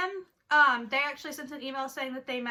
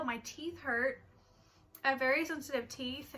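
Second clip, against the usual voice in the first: ordinary talk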